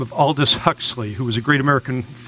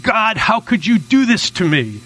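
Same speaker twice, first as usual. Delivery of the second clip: high, slow plea hurled at heaven; grandmother's mild wail at the sky; mock scream; 6 semitones higher than usual. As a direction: cry out to God, half acted. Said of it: "and she screams".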